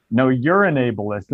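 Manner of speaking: turning the insult back, slow and mocking; mocking echo. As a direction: parrot it back slow and smug. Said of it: "then he just said".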